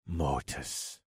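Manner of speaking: low, soft incantation; inner voice. Acted. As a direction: murmur the spell inwardly. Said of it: "recited the incantation inside my head".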